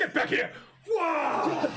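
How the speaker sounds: high, laughing yell; laughing mock yell. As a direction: play the chase while laughing. Imagined chase scene.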